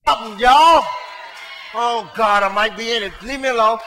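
loud, driving preacher's exhortation; fiery preaching. Acted. Pastor urging the church to pray before Sunday, loud preaching. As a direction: push it hard at the congregation.

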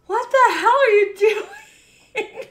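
high, slow, laughing outcry; disbelieving squeal; stunned laughing disbelief. Real, not acted. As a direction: laugh it out in shock. Reacting to a big donation.

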